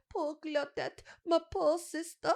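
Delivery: high, drawn-out melodramatic lament; garbled kid impression. Replaying his murder mystery line, garbled kid impression. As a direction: mimic an overacting gap-toothed kid, slowing at the end. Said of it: "that's exactly what you said".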